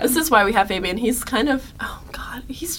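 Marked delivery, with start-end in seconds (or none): 1.8-2.8 quick unvoiced aside; whisper. Real, not acted. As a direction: whisper it like a secret. Aside whisper about Fabian.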